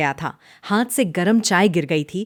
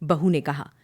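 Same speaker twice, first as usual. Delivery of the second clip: usual speaking voice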